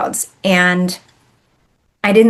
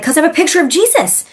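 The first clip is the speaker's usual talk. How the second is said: high, quick, worked-up retort; retold freak-out; 9 semitones higher, faster than usual. Woman telling her calm seatmate why she's rattled, freaked-out. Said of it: "I'm freaking out".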